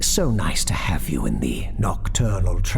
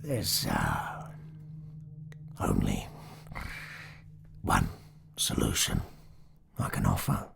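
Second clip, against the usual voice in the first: very low, halting voice; grave Stanley figure; 13 semitones lower, 11 dB quieter than usual. A narrator's reading. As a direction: offer the grim verdict haltingly, pitched way down. Surgeon figure delivers grim verdict.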